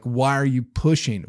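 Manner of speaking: usual speaking voice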